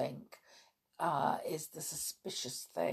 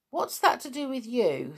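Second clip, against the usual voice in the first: sudden sharp challenge, higher; rude; 5 semitones higher, 5 dB louder, faster than usual. Acted. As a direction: cut in rudely with suspicion. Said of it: "Dick sounded rather rude".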